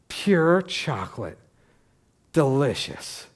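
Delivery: slow, drawn-out mock relish; savouring. Pastor imitates older woman eating dog treat, slow savouring.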